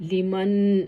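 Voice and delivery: لمن said as "la-man" with a firm final n; clear nun sound. Shown as a demonstration. Teacher demonstrating nun with sukoon, n clearly sounded. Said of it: "نون پر سکون دیا ہوا ہے".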